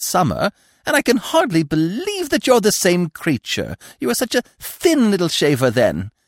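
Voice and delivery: high, effusive squirrel chatter. A narrator's reading. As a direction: marvel on and on in a high voice. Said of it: "the squirrel kept on talking".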